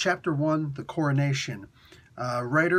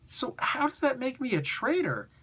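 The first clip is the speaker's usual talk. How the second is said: indignant king; 11 semitones higher than usual. Retold or imitated. King argues with emperor.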